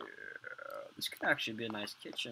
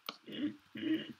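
sudden very high squeaky laugh-cough; a strange yelp of a laugh that surprises himself; weird laugh. Real, not acted. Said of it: "that was a weird laugh".

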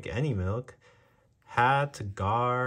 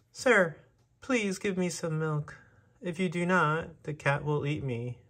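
high, pleading little mouse voice; begging mouse; 7 semitones higher than usual. A narrator's reading. Mouse begs the ranch owner for milk, high and pleading. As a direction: beg the rancher like a tiny mouse. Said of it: "the mouse begged".

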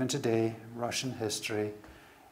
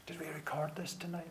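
hushed, panicked whisper; urgent whisper; 4 semitones higher, 13 dB quieter, faster than usual. Retold or imitated. Producer whispering to his staff in panic, hushed. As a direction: breathe it out in fear.

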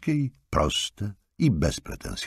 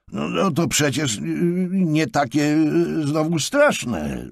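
hesitant, higher excusing voice; making excuses; 12 semitones higher, 4 dB louder, slower than usual. A narrator's reading. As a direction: mumble an excuse, stalling. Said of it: "zaczął usprawiedliwiać się Markus". (niepewne tłumaczenie się)

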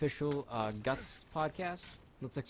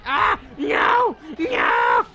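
shouting no, much louder and higher; screaming; 14 semitones higher, 13 dB louder, much slower than usual. Real, not acted. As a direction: scream as if torn apart. Character ripped in two.

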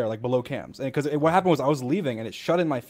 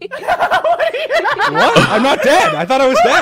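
high, loud laughing disbelief; surprised laugh; 8 dB louder, much slower than usual. Real, not acted. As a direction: laugh in shock at being alive. Surprised, relieved to be alive.

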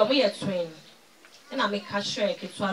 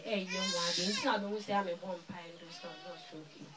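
real crying; 3 semitones lower, 12 dB quieter than usual. Real, not acted. Recalling her mother's death.

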